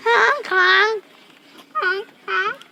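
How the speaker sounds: loud goofy horn noises; silly clown honking. Pretend. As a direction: honk like a clown car. Mimicking horn, laughing.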